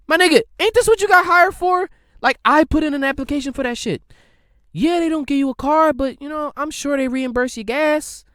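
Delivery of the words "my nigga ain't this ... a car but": loud, high-pitched outrage at the delivery guy; going off, louder and higher with every line; yelling at the pizza guy over the tip; exasperated rant. Pretend.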